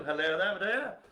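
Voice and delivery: bright, high sing-song hello; cheery greeting. Real, not acted. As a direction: call it out cheerily. Greeting a viewer.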